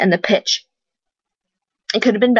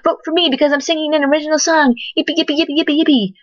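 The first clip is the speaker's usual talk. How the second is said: mocking a show-off; 6 semitones higher, faster than usual. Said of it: "all you're doing is saying".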